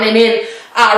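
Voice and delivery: ordinary talk, nothing marked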